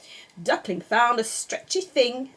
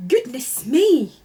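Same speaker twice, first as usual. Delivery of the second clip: gasped; 3 semitones higher, 7 dB louder, slower than usual. A narrator's reading. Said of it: "gasped Mister Rabbit".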